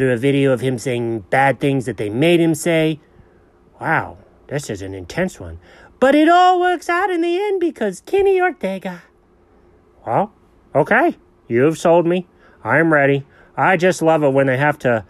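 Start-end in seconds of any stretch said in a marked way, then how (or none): 6.0-9.0 high, fast pet voice telling the plot; dog voice. Acted. Dog eagerly retelling the movie to the owner, high voice.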